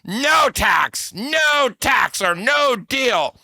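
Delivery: high, drawn-out mock shout; mock yell, stretching each refusal; Trump. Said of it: "Trump says".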